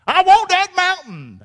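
slow, high and loud with WANT stressed; determined claim. Acted. Caleb repeating I want that mountain, slow and emphatic. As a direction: stretch out I want that mountain like an old rascal. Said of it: "Caleb was".

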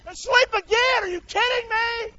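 very high, exasperated incredulous cry; acted disbelief. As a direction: voice outraged disbelief at the sleepers. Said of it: "This is me thinking".

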